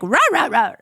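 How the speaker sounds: dog barking back in conversation; quick run of "rah" barks; imitated dog bark. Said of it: "conversing with me".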